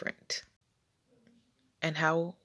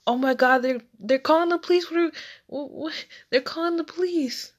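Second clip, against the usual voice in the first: frantic, much higher and louder, tumbling out fast; a scared child bursting in on mom and dad; panicked kid; 10 semitones higher, 5 dB louder than usual. Retold or imitated. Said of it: "so flustered".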